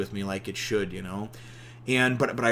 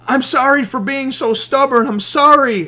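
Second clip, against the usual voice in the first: louder, higher begging sorry; high tearful apology; 12 semitones higher, 10 dB louder than usual. Retold or imitated. Usopp apologizing to his crew, high tearful shout. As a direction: cry it out like a broken friend.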